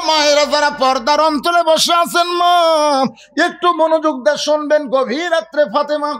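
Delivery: high-pitched, projected appeal; impassioned preaching. Real, not acted. Preacher calling mothers behind the curtain to listen, impassioned. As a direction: call out to the listeners at the back.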